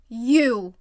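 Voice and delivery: loud accusing shout. Acted. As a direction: jab it out accusingly. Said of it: "pointed a finger accusingly".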